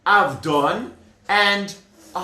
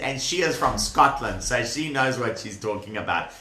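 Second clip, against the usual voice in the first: usual speaking voice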